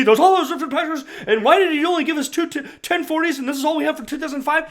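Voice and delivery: high, fast, laughing parody voice; mocking the reporter. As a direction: make her question sound silly. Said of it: "So for her to say".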